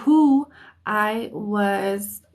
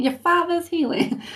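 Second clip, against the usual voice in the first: put-on higher-pitched East Coast accent; 7 semitones higher than usual. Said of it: "almost an East Coast type of accent".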